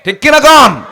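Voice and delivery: high, very loud challenge; rallying shout. Real, not acted. Preacher demands assent from the crowd, rallying shout.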